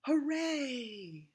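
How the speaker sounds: high, far-off echoing hooray; echo shout. A narrator's reading. The echo cheers back from across the pond. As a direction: let it ring back from afar. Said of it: "the echo shouted back at them".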